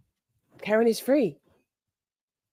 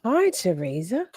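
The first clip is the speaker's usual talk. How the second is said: ordinary delivery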